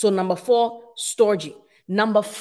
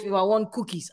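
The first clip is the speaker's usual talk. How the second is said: usual speaking voice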